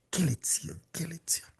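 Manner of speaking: hushed awe. Real, not acted. Preacher softly marvels at the lesson for the congregation. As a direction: let it drop to a murmur.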